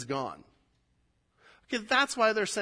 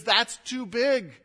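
mock objection; 7 semitones higher, 5 dB louder, slower than usual. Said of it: "one of our objections is".

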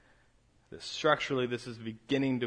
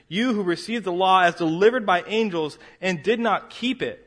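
forceful preacher reading of scripture, climbing louder; 5 dB louder than usual. Acted. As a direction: read it like a prophet's rebuke, building. Reads Stephen's speech aloud.

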